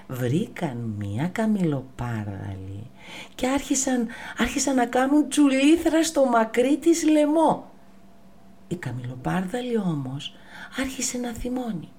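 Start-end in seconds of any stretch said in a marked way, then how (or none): 3.4-7.7 playful. A narrator's reading. Playful monkeys sliding.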